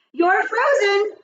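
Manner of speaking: high, drawn-out freeze-tag call; playground call-out. A narrator's reading. Boy tags her in freeze tag.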